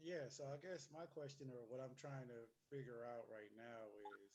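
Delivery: muffled, far from the microphone; far from mic. Real, not acted. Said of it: "it sounds like you're far away".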